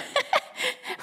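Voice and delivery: high-pitched burst of giggles; sudden laugh. A narrator's reading. Christoph spots dirt on Freddy's nose, sudden giggle. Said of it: "Plötzlich muss er lachen".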